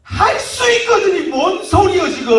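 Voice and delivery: high, loud, indignant outburst; angry rebuke. Acted. Jesus to the doubting father, angry rebuke. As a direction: snap it back in loud disbelief. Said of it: "화내면서 말씀하십니다".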